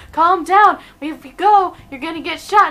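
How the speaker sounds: high, loud acted plea; urgent mock warning. Voicing everyone warning the freaking-out ladybird, urgent. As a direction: loudly urge a panicking friend to stop.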